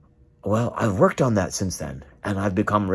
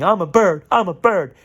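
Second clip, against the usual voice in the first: mock movie voiceover; 7 semitones higher, 3 dB louder than usual. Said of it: "it sounds with a voiceover".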